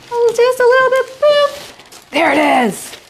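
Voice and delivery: thrilled as the lattice lands; excited. Real, not acted. Baker squealing with delight as the lattice lands on the pie. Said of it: "It's so exciting".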